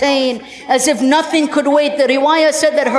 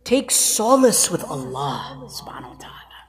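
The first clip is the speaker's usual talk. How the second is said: soft low whisper of comfort; 7 semitones lower, 5 dB quieter than usual. Acted. Hussein consoling his sister, a soft whisper. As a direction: breathe it to her gently.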